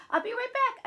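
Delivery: put-on child's high-pitched parting shout; yelling. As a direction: yell it to uncle as you run off. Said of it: "I yell to Uncle Frankie".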